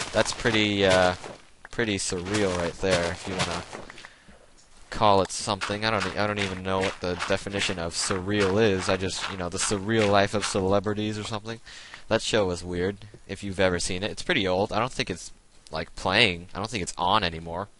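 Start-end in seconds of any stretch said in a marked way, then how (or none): none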